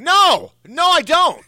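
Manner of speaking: high, shouted denial; loud protest. Real, not acted. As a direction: burst out in indignant denial. Protests co-host's teasing accusation.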